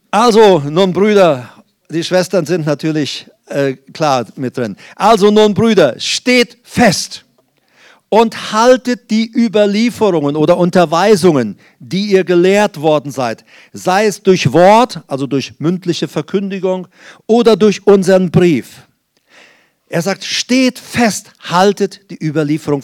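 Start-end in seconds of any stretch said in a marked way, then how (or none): 0.1-1.5 "Also" lifted and stressed, louder; emphatic restart. Shown as a demonstration. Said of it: "sorry, ihr Schwestern". 5.0-18.9 preaching scripture read. Acted. Reads scripture with force.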